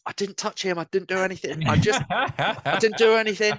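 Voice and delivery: high-pitched, hammed-up self-defence; panicked protest. Mate denying she hurt the man, imitated panicked protest. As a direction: plead innocence in a mock fluster.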